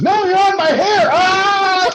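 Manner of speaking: high loud shrieking wife, hammed up; wife in pain. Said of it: "And she goes".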